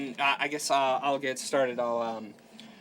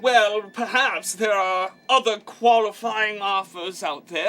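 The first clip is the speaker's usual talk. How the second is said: grand fairmaster; 6 semitones higher, 5 dB louder than usual. Acted. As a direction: send the crowd off with theatrical courtesy. Fairmaster dismisses the crowd.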